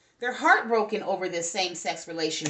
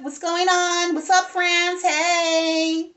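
high, louder sing-song hello; excited greeting; 8 semitones higher, 6 dB louder, much slower than usual. Real, not acted. Reader greets viewers in France with a high, loud, sing-song hello. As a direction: greet the viewers like friends across a room.

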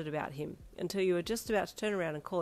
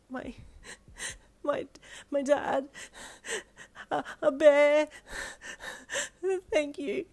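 high, halting sobs between words; acted tearful stammer with gasps; sobbing; 9 semitones higher, 5 dB louder, slower than usual. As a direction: choke it out through tears. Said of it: "you sobbed".

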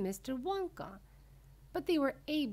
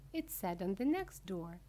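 ordinary delivery

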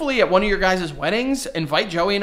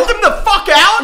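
much higher, louder hammed-up shout; mock hostile yell; 9 dB louder than usual. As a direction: order them out in fake rage. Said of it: "They're like".

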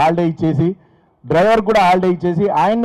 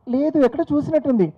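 haughty madam voice; 7 semitones higher, 4 dB quieter than usual. Retold or imitated. Wife answering attendant.

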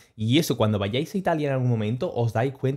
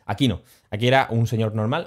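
usual speaking voice

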